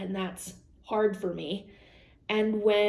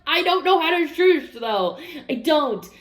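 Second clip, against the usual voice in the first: laughing protest; 6 semitones higher, 6 dB louder than usual. Retold or imitated. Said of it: "I'm like".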